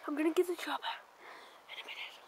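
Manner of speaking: soft hiding whisper. Acted. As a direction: keep it under your breath. Hiding from enemies.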